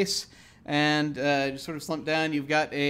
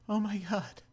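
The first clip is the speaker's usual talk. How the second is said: softer, lightly acted astonishment; hushed alarm; 3 semitones higher, 7 dB quieter than usual. Startled couple looking around, hushed alarm. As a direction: murmur it in mock shock.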